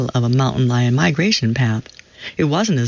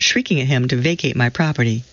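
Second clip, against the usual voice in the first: plain reading voice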